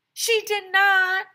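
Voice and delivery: high, loud and slow protest; child snapping back in an argument; indignant shout. A narrator's reading. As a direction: stretch out the denial. Kids arguing over reading turn.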